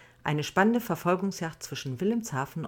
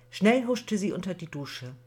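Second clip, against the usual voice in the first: ordinary delivery, nothing marked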